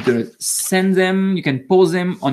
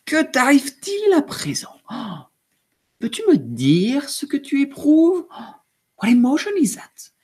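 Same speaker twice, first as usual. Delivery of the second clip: higher, wondering questioning voice; curious question; 7 semitones higher than usual. A narrator's reading. Narrator asking the little monster what she feels, higher and curious. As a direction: ask the child tenderly what she feels. Book asks the little girl.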